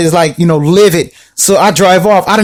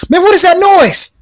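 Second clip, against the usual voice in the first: startled; 11 semitones higher than usual. Retold or imitated. Startled by dog noise.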